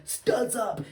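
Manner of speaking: mock referee call. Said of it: "they'll just be like".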